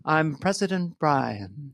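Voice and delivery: soft, very slow, soothing impression; President Brian. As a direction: murmur it calmly, stretching it out. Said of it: "This is my impression of our next president".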